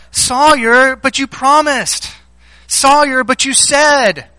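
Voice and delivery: high, drawn-out imitation of a wronged child; mock childish protest. Daughter protesting to her sister in the car, imitated plaintive whine. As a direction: plead like an aggrieved little girl, stretching it out.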